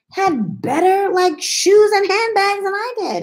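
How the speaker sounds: a high, drawn-out camp impression. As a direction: sing it out high like a sassy queen.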